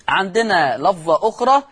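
ordinary delivery

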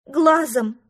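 loud whining; whimpering. Acted. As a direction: wail the word. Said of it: "подвывал он".

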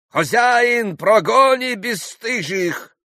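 high-pitched, drawn-out call to the landlord; shouted demand. A narrator's reading. Pan calls the landlord to expel singers, shouting. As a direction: shout for them to be thrown out. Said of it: "слыша крик".

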